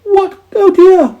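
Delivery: very high, loud squeaky cry; frightened mice. A narrator's reading. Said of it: "said the mice".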